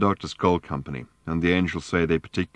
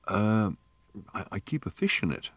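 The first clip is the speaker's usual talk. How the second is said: slightly embarrassed; 3 semitones higher, 4 dB quieter than usual. A narrator's reading. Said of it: "slightly embarrassed".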